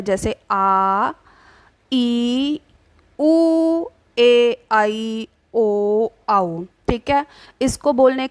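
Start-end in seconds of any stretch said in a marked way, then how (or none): 0.0-6.6 आ, ई, उ, ए held out long and slow; long vowels demo. Shown as a demonstration. Teacher showing long vowels take twice the time, held out. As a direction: stretch each long vowel to double length. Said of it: "दुगना समय हमें दीर्घ स्वर में बोलने में लगता है".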